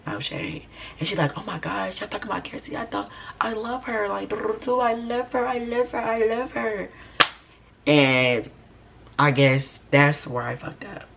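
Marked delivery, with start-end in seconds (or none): 1.4-6.9 high, soft mock-sweet voice; gushing mimicry. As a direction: imitate her fawning in a small high pitch. Imitating her gushing about Cassie.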